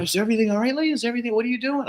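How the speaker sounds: high, lightly voiced worried neighbor; voicing the neighbor. Neighbor running out to the driveway, a high, lightly voiced worried lady. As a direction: mimic her rushing out asking.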